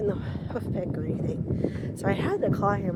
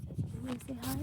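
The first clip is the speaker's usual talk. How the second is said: put-on soft baby voice; 4 semitones higher, 11 dB quieter than usual. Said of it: "She says".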